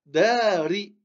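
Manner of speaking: "Dari" with "Da" drawn out, "ri" not lengthened; long first syllable. Shown as a demonstration. Teacher repeating after the rule, long "Da" in "Dari". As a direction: hold the "a" of "Dari", then release into "ri". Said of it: "On allonge le da et on prononce le ri".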